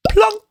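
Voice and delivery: high plunk sound like a marble in water; imitated plunk. Shown as a demonstration. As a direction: pop it out high like a drop. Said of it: "and it goes".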